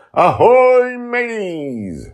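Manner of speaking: very high, loud pirate hail; Justin's booming pirate greeting on deck; pirate shout. Acted. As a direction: holler ahoy across the deck. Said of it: "and immediately shouts".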